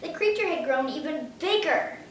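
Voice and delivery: usual speaking voice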